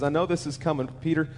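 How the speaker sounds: low, softened, confiding voice; tender reassurance. Acted. Jesus assuring Peter of his prayers, tenderly. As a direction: speak low and soft, as if privately to a friend. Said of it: "Jesus looks at him and says".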